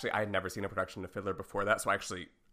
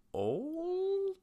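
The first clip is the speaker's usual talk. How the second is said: high drawn-out. Pretend. Held high drawn-out note.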